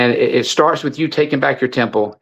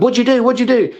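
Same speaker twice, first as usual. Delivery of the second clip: alarmed father; 8 semitones higher than usual. Retold or imitated. Father finds daughter in distress.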